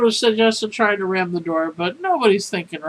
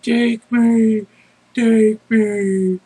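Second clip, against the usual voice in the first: mock shouting; 2 semitones higher, 4 dB louder, much slower than usual. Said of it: "he's in his boxers shouting".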